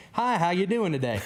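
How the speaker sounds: mock a cheery Southern stranger; friendly stranger. Mimicking a chipper Southern stranger greeting him on the street.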